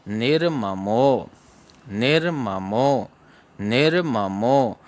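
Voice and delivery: निर्ममो said slowly, syllable by syllable; teacher drills निर्ममो slowly; slow recitation. Shown as a demonstration. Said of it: "तृतीय चरण में चलते हैं".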